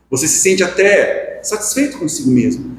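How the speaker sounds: normal talking voice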